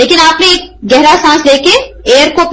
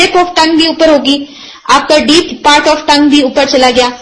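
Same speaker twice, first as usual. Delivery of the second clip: ordinary talk, nothing marked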